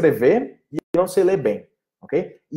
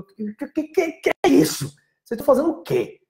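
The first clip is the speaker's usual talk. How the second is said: sharply higher, exasperated what-is-this; incredulous; 12 semitones higher than usual. Real, not acted. Teacher scolding students who translate, with incredulous disbelief. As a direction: react like you caught students cheating at translation.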